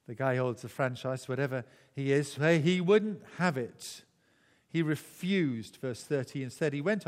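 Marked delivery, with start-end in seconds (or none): none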